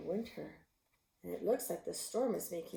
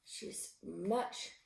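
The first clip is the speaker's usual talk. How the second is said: plain reading voice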